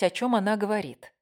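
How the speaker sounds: ordinary delivery, nothing marked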